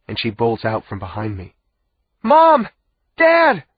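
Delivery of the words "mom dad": high, loud desperate shout; crying out. A narrator's reading. Girl bolting toward her parents in the dark, crying out. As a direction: shout out for them at full voice. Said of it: "she cries".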